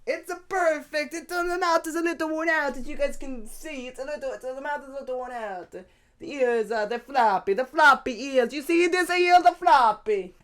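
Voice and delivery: put-on high-pitched comic Italian accent. Host imitating fursuit maker showing floppy ears, mock Italian accent. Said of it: "Everyone in my mind has an Italian accent".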